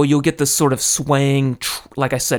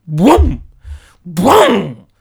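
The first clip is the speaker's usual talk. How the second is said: imitated boom; 3 semitones higher, 5 dB louder than usual. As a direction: mimic the building being hit. Imitating the quake hitting building.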